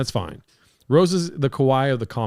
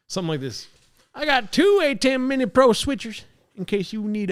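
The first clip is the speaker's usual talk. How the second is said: Theo Von; 5 semitones higher than usual. Pretend. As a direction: brag like a lazy Southern podcaster. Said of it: "It's so easy to be Theo Von".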